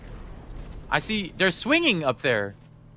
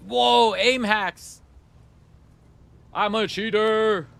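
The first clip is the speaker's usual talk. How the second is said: mock amazement; 4 semitones higher, 3 dB louder, much slower than usual. Jokes about seeing through walls.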